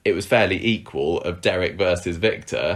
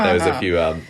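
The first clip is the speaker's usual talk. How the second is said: usual speaking voice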